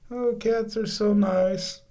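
slow sweet high coo. Real, not acted. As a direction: coo over the cats. Cooing about cats.

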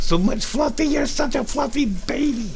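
climbing into high pet-cooing. Real, not acted. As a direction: gush over a pet, pitch rising to squeaky.

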